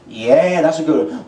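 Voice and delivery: ordinary talk, nothing marked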